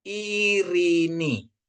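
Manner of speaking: Irini sung out high and very slow, ei as one I; slow careful demo. Shown as a demonstration. Teacher demonstrates Irini slowly for the class. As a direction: hold each syllable of Irini like a model reading. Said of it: "Jadi bacanya".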